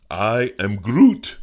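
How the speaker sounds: big dramatic Groot line; booming Groot. Pretend. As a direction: stretch out a huge Groot roar. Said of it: "I didn't get to say".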